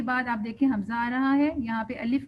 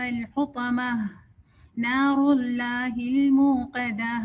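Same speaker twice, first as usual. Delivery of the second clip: slow, measured tajweed recitation; Quran recitation; much slower than usual. Acted. Teacher reciting the surah for students, slow and measured. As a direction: recite each verse slowly and carefully.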